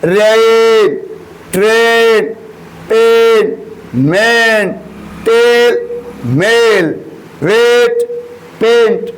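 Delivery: each word drawn out clearly for the class; teacher modelling words. Shown as a demonstration. Teacher reads example words aloud to class, carefully articulated. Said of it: "तो example है".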